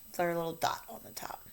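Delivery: quick whispered aside; whispered reminder. Real, not acted. Teacher whispering a quick hint to students. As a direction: slip the reminder in as a fast whisper.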